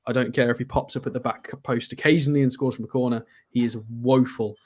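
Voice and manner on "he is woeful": usual speaking voice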